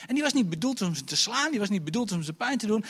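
normal talking voice

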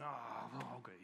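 hushed, half-whispered grudging reply; reluctant mumble. Retold or imitated. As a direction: mutter it like a doubtful fisherman giving in. Peter answers Jesus reluctantly.

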